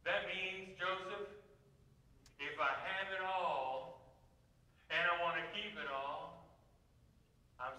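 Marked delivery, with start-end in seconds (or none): none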